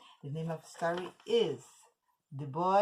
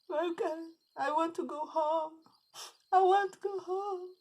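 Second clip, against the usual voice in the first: put-on crying boy; 10 semitones higher, 4 dB louder than usual. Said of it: "The boy started to cry".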